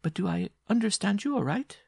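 very high woman's questioning voice; amused giantess. Acted.

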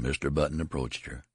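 ordinary delivery, nothing marked

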